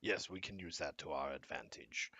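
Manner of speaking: "yes, we can use that to our advantage" in a soft, voiceless whisper. Shown as a demonstration. Game master redoing the archivist line as a whisper. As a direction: breathe the line out with no voice. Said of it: "hold on one second".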